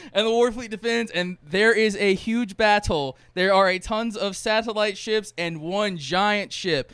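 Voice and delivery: big trailer-style build-up; epic hype narration. Real, not acted. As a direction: punch out each word for grandeur. Hyping the movie's huge battle.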